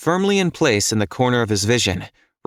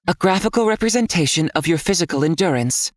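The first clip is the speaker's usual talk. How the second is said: higher, detached inner AI voice; an all-knowing guide speaking inside his head; calm system voice; 7 semitones higher, faster than usual. A narrator's reading. System voice answers Nick.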